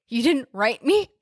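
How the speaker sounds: lightly drawled Southern lover's plea; a hint of Southern movie romance; Southern accent. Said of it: "I keep doing such Southern accents".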